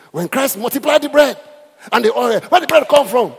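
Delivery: high and loud; imitating a shouting preacher; preacher's shout. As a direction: hurl the question like a preacher. Preacher voiced in story.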